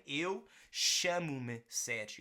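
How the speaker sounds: slow, careful "eu, chamo-me Sérgio"; slow model sentence. Shown as a demonstration. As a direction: say it slowly for learners to copy. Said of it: "model sentence".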